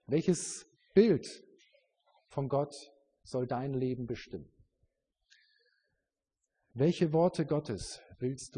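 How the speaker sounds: loud, forceful sermon voice; preaching. Acted. Preacher calling out to his congregation, loud and forceful. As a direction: hammer the questions home to the church.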